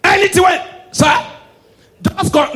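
high, loud and slow laughing cry; laughing shout. Real, not acted. Comedian hammers home his punchline to the crowd, laughing loudly. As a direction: belt it out through laughter.

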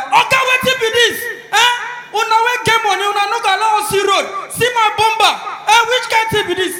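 high, loud outraged driver; hammed-up shouting tirade; angry ranting. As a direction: yell a furious roadside complaint. Poor man rages after crash.

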